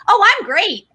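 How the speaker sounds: high chirpy reflex answer; automatic cheerful. Retold or imitated. To a passing greeter, automatic cheerful. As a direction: toss off a breezy reply. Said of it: "quick to answer".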